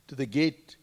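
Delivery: usual speaking voice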